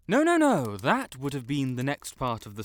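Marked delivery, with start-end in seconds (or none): 0.1-0.8 loud panicked no. Acted. Panicked denial of the hex.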